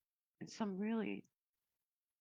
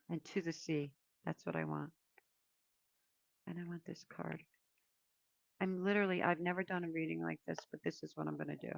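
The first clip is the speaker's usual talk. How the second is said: ordinary talk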